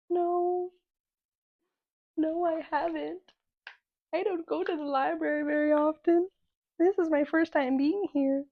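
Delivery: high, slow, small put-on voice; bashful. Flustered patron answering the librarian, bashful. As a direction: draw it out meekly in a high pitch.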